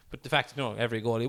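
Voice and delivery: ordinary talk, nothing marked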